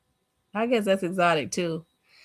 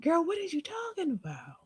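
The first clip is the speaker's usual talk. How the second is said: incredulous high voice; 8 semitones higher, 10 dB quieter than usual. Real, not acted. Incredulous reaction.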